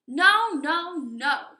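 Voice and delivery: small wolves' defiant no; loud refusal. Acted. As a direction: refuse the pig firmly.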